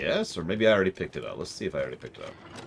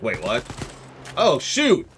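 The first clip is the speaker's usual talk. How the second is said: surprised; 5 semitones higher, 4 dB louder, slower than usual. Acted. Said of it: "Luke's like".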